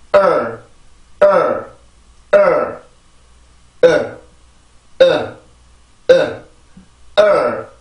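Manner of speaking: isolated 어 beats, strong and weak; short separate 어 sounds with gaps between; rhythm drill. Shown as a demonstration. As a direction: beat out the vowel rhythm on 어. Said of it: "한번 리듬을 만들어 보죠".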